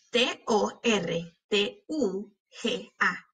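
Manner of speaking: slow, letter by letter; spelling out. Shown as a demonstration. Teacher spelling tortuga for pupils, letter by letter. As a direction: leave a gap after each letter. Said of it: "cuando estamos deletreando estas palabras".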